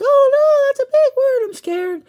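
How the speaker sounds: high, hammed-up little scared voice; mock scared kid. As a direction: play a child panicking at a big word. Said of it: "you look at it and you're like".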